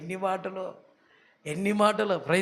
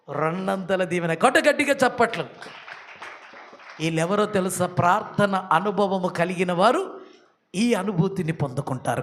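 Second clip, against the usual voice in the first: normal talking voice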